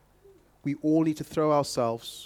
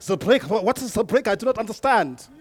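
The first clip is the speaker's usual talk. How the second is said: mock French accent, puzzled; a light French accent; 6 semitones higher, 4 dB louder than usual. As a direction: play a bewildered French perfume maker. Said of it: "That's my French accent".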